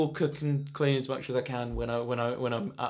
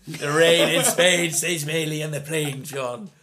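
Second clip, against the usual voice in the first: slow, drawn-out elocution coaching; hammed-up mentor intoning a diction drill; Dara O'Brien; 5 dB louder than usual. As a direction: coach the line syllable by syllable. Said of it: "Dara O'Brien was massaging his shoulders".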